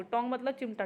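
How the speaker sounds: normal talking voice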